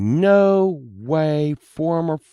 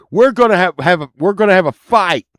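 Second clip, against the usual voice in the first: mock declaration; 5 semitones higher, 7 dB louder, faster than usual. [name] declares a fight.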